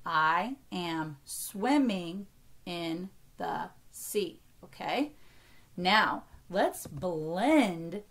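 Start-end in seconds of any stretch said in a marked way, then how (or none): 0.1-4.4 slow and evenly separated words. Shown as a demonstration. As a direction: say each word apart, no split inside words. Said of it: "only count each word as one".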